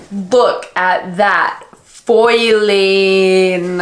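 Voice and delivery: very slow, stretched-out admiration; drawn-out awe. Real, not acted. Vlogger showing off book foiling, slow and awed. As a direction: linger on every word, admiring.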